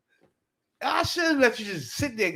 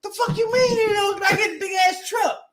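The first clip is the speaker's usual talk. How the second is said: high-pitched outraged shouting; retold yelling; 12 semitones higher than usual. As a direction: shout at the driver who hit you. Yelling at the sleeping driver.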